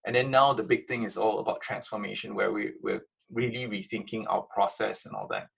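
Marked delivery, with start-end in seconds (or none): none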